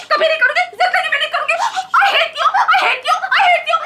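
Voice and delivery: high, loud, repeated shriek; furious tantrum. Acted. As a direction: scream it at him over and over. Wife's tantrum, repeated I hate you. (चीखते हुए गुस्से में)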